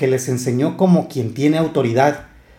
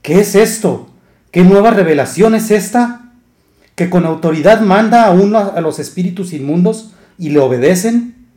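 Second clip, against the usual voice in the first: raised, astonished questioning; the synagogue crowd asking each other, raised and amazed; 5 semitones higher, 4 dB louder than usual. Acted. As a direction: marvel aloud at the miracle. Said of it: "discutían entre sí".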